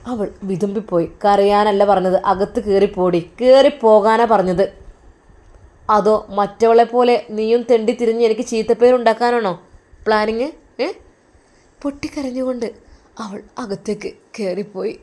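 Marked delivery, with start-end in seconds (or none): 1.2-10.9 loud, furious brother shouting; angry scolding. Acted. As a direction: bark orders at the crying sister. Brother scolds crying sister.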